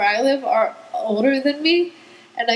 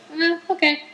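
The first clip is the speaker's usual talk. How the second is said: higher, half-hearted agreement; unimpressed little boy; 6 semitones higher than usual. Retold or imitated. Little boy offered an Ezra picture too, lukewarm acceptance. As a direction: imitate a kid reluctantly settling. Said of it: "And he's like".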